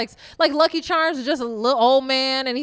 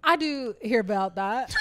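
usual speaking voice

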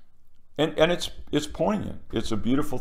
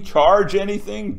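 ordinary talk, nothing marked

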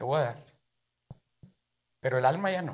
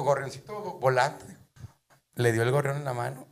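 normal talking voice

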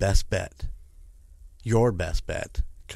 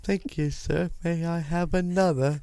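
higher, a hammed-up British accent; mock British accent; 8 semitones higher than usual. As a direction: play a polite British robot kid with a British accent. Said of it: "We went British".

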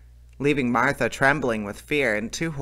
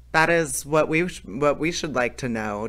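plain reading voice

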